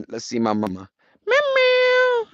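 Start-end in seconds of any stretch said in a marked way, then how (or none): 1.3-2.3 put-on much higher meowing baby voice to the cat. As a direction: coax the kitten with tiny high meows. Calling his cat to play.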